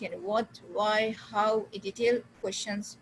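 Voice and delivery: normal talking voice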